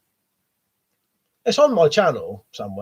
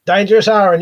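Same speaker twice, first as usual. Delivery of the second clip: normal talking voice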